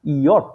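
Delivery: ইয়ট said as the German letter name; German letter J named clearly. Shown as a demonstration. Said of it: "হয়ে যাচ্ছে".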